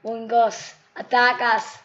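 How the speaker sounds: retelling what he yells, a loud battle cry; shouted command. Retold or imitated. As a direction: yell the order at full voice. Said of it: "and I say".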